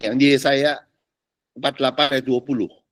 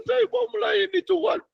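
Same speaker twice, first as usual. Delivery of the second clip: whiny believer; 6 dB quieter than usual. Retold or imitated. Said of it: "Otaknya belum".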